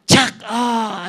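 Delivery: sharp high yelp of imagined pain; mock cry of pain. Child acting out the whip, mock pained yelp. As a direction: cry out as if already whipped.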